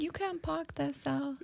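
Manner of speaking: understated monotone, mock British. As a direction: mimic an unbothered British man, softly. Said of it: "He just said it so monotone".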